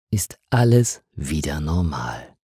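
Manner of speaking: ordinary delivery, nothing marked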